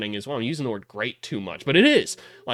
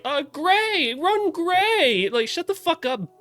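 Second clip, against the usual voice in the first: high, showy play call; mocking ball-hog guard; slower than usual. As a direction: imitate a guard who loves to dribble. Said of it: "be like".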